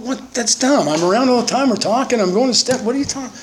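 high-pitched incredulous protest; indignant disbelief. Retold or imitated. Young speaker protesting to friends, high indignant disbelief. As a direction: squeal in disbelief at the idea. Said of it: "I went".

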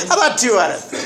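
a bright laughing replay of his own bargain. Retold or imitated. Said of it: "That's what I remember saying".

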